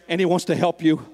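ordinary talk, nothing marked

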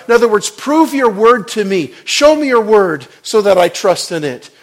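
high, loud fiery sermon delivery; full-force preaching. Acted. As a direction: preach it out, building to a roar.

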